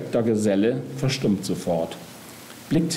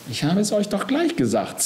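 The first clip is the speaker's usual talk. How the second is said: high-pitched, understated irritated reproach; irritated hiss; 12 semitones higher than usual. A narrator's reading. Death to the other two, time being wasted, irritated hiss. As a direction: scold them with a touch of venom. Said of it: "zischt der Tod".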